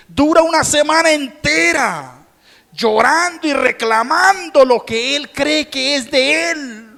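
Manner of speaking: loud high fervent preaching; impassioned pulpit sermon; preaching at full force. Acted. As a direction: pour it out to the congregation, full volume.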